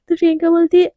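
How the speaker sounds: ordinary delivery, nothing marked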